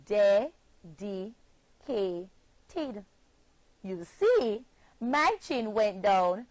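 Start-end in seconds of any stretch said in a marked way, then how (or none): 0.1-1.4 dedicated drawn out slowly, syllable by syllable; slow demonstration. Shown as a demonstration. Teacher demonstrating to the children, slowly. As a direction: say dedicated slowly for the chin count. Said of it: "let me do it for you".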